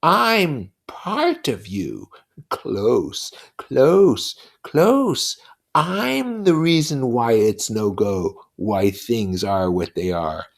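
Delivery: put-on a buzzing devil taunting a child; Lord of the Flies, mocking. Lord of the Flies taunting Simon, slow and sneering.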